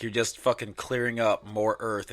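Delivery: normal talking voice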